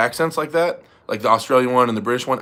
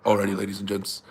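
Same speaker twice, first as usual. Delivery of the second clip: low, softer attempt at a deeper voice; quietly lowered impersonation; [name]; 5 semitones lower, 6 dB quieter than usual. Shown as a demonstration. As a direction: sink into a deep presenter greeting. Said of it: "it is deeper voice".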